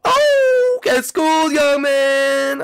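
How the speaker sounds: high, loud drawn-out jeer; triumphant taunt. Pretend. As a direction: holler it gloatingly at your victim. Taunts opponent in game.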